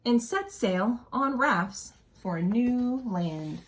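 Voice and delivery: storybook narration for a class; expressive read-aloud story voice; reading aloud to children. A narrator's reading. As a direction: read it like a teacher sharing a picture book. Reading picture book aloud.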